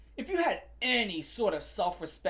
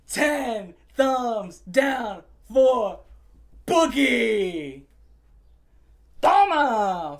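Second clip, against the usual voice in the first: slow, drawn-out and much louder, announced like a verdict; 3 semitones higher, 7 dB louder, much slower than usual. Real, not acted. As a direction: bellow the final verdict. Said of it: "I worked up a fucking sweat getting pissed".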